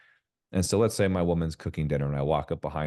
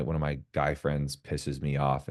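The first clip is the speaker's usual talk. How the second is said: ordinary talk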